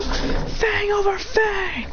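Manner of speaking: high, stretched attack call; battle cry. Acted. Kiba launching his attack at the demon, battle cry. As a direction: call the move out, long and high.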